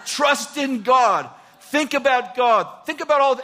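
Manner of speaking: rallying shout. Retold or imitated. David rallying his own soul.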